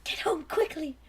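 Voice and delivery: hushed whisper under the breath; murmured whisper. Acted. Rat Trap murmurs to himself in a whisper. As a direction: whisper it to yourself. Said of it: "he murmured to himself".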